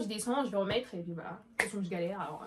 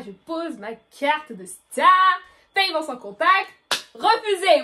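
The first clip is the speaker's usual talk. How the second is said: slow, louder and higher to the punch; dramatic buildup; 5 semitones higher, 8 dB louder, much slower than usual. Acted. Teen telling friends the card scene, slow dramatic buildup. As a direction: drag it out, then drop "Refusé".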